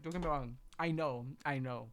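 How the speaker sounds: normal talking voice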